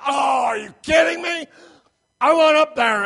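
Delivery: exasperated high shout, imitating an impatient driver; loud, high-pitched outraged yelling; going ballistic. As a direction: blow up at the car in front. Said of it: "he's just going ballistic".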